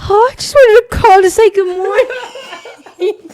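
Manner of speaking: high put-on friend voice, sweet and chipper; high chipper friend impression; imitating a friend. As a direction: mimic your friend calling to say good morning. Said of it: "that's what I sound like?".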